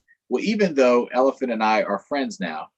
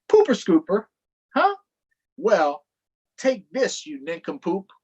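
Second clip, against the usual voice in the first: high, louder cartoon retort; hammed-up insult; 7 semitones higher, 5 dB louder than usual. Elephant throwing mud at Donkey, high mock retort. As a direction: fire back the insult.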